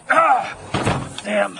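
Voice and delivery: loud, high yelp then curse; cry of pain. Acted. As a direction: yelp as it hurts. Man hurts himself.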